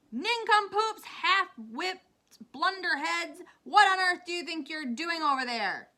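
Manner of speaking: high, loud taunting yell; yelling full force. A narrator's reading. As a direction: hurl insults across a distance. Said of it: "yelling at the Cloud Men as loud as he could".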